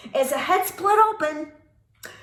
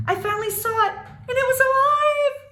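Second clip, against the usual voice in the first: dramatic; 10 semitones higher, 4 dB louder than usual. A narrator's reading.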